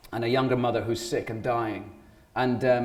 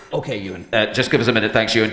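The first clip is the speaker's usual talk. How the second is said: studio talkback voice; 2 semitones lower, 6 dB louder, much faster than usual. Retold or imitated. Studio staff stalling the singer from behind glass, imitated talkback voice. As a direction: imitate a noncommittal producer on the intercom.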